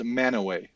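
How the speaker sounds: Manaway said "MAN-a-way"; Manaway, no T, ending in "way"; local pronunciation. Shown as a demonstration. Repeating the Utah town's local name, pronounced Manaway. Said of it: "It's pronounced".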